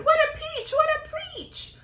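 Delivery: very high, loud cry of joy; joyful shout. Acted. Aunt shouting with joy at the huge peach. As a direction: whoop it to the sky.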